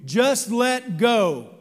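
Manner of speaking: loud, lightly voiced calm divine command, slowing at the end; the Lord. God telling the hiker to let go, slow and loud. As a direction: reassure him, then draw out let go. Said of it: "And he says".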